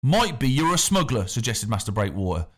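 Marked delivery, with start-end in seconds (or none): 0.0-1.3 lightly voiced smug official; a smooth, superior official waving people off; the factor. A narrator's reading. The factor sends the crowd away, lightly voiced and smug.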